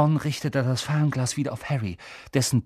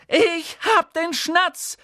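much higher, slow triumphant cry; triumphant shout; 3 dB louder, much slower than usual. Acted. Harry showing the Snitch, triumphant. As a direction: call it out in triumph. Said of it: "rief er".

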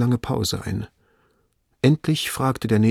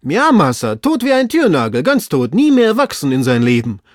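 high, loud lament called down; emphatic servant; 6 semitones higher, 7 dB louder than usual. Acted. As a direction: wail that it is hopeless. Servant insists from the tree.

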